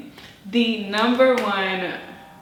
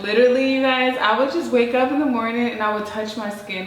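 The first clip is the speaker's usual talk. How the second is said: excited; 4 semitones higher, 4 dB louder than usual. Real, not acted. Excited gushing.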